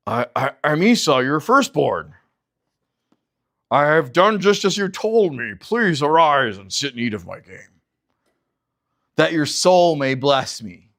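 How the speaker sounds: lightly altered false voice; a hint of a faked brother's voice; Jacob faking Esau. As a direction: bluff through the lie.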